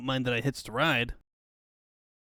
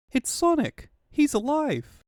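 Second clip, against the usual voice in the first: much higher, excited cry of joy; overjoyed shout; 12 semitones higher, 4 dB louder than usual. Acted. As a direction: burst out with delight. Tails sees Sonic alive.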